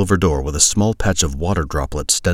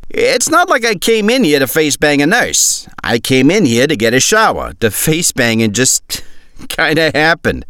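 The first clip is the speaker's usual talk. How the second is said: high, loud laughing shrug-off; cocky soldier; 10 semitones higher, 5 dB louder than usual. Acted. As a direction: brush it off with a chuckle. Voices Dago's excuse.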